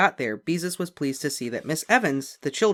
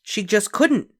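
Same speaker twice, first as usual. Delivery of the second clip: ordinary delivery, nothing marked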